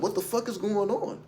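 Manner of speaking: retold bewilderment, voice lifted high; bewildered disbelief. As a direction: relive the confusion, pitch jumping up. Reliving paralysis on the floor.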